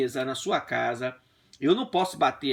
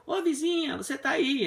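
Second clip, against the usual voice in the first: high calling out to the neighbour; 9 semitones higher than usual. Retold or imitated. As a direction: call across the wall, high and bright. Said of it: "A vizinha grita". (chamando a vizinha, voz aguda)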